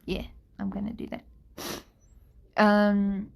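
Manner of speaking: ordinary talk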